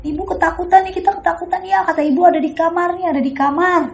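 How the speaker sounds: high frightened plea to father; scared calling. Retold or imitated. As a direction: plead upward, afraid.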